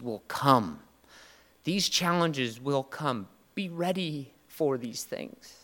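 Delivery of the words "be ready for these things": slow and softer, emotion welling; emotional, choked up. Real, not acted. Pastor tearing up about his sons, slow and softer. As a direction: hold back tears, slowly. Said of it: "Sorry, I'm emotional when I start talking about".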